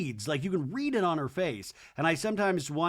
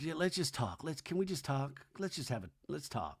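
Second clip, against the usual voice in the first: much softer pleading murmur; soft JT plea; 10 dB quieter than usual. Retold or imitated. JT pleads with Venita at the hotel, soft murmur. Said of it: "and J T's like".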